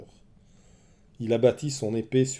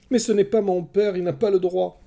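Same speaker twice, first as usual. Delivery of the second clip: put-on high, loud protesting little boy; a small boy standing his ground, higher and louder; insistent child; 10 semitones higher, 7 dB louder than usual. A narrator's reading. Young Oreste insisting to his mother, high protesting child.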